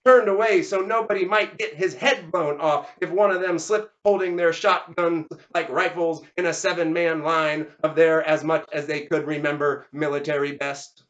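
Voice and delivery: usual speaking voice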